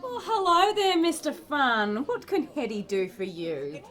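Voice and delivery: hammed-up Australian café host; silly Australian accent. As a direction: put on a silly Aussie accent. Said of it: "You can drop that silly accent".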